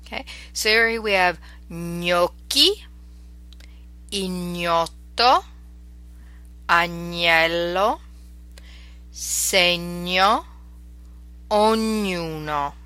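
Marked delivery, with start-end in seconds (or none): 1.7-12.7 "gnocchi, ignoto, agnello, segno, ognuno" with gn as a lingering ny; slow, stretched words, gn said like Spanish ñ; Italian GN sound. Shown as a demonstration. Teacher modelling GN words, slow Italian ny sound. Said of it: "G N makes a distinctive ñ".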